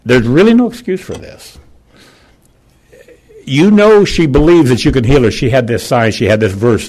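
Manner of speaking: retelling a furious prayer, voice climbing; throwing a fit. Retold or imitated. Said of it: "And I throwed a fit".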